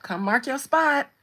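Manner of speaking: high, loud teasing call to a husband to come claim his spot; hammed-up mock summons to a husband. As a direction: summon him like a dog to mark territory. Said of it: "I'm like".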